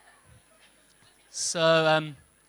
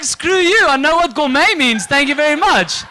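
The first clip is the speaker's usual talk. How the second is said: loud, high mock indignation; indignant outburst; 7 semitones higher, 8 dB louder than usual. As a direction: snap back at the waitress, offended. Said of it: "I was like".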